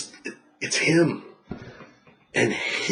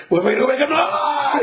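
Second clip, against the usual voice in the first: mock panicked cry going higher; 13 semitones higher, 4 dB louder than usual. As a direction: ham up the dread of the drop. Mock roller coaster panic.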